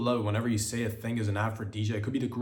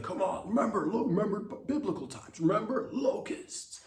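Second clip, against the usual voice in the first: mock God voice; 9 semitones higher than usual. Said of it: "God's like".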